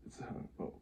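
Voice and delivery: unvoiced whispered aside; whispering. Real, not acted. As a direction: breathe it out under your breath. Whispered aside while reading.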